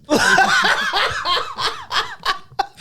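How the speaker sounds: high, loud burst of helpless laughter; loud laughing fit. Real, not acted. Comic cracking up at his own episode title, loud laughing fit.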